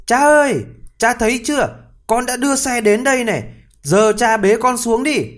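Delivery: high, loud calling to father. Acted. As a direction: proudly call across to father. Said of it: "tí hon đã gọi".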